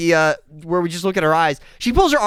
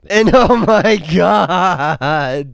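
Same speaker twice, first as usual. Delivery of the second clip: slow, laughing oh my God; stunned laugh, words stretched out; laughing in disbelief; 4 dB louder, much slower than usual. Real, not acted. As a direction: break into real laughter at the sight. Reviewer shocked by stepmom's look.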